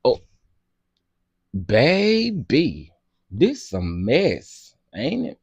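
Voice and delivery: slow, low and soft; weary sigh. Real, not acted. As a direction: shake your head at the mess. Sighing at the messy story.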